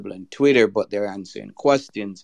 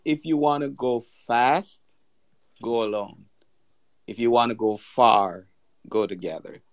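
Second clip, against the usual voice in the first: slow, spaced out, pausing between each phrase; slower repeat. Real, not acted. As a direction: repeat it slowly so someone can write it down. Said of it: "Can you say that again and go slower".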